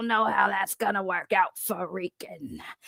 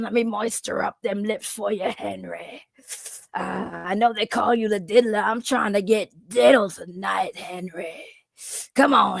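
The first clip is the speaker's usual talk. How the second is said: Glizzy Goblin voice. Acted. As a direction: growl come-ons at Henry like a crude goblin. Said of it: "The Glizzy Goblin".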